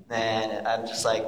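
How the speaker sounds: ordinary talk, nothing marked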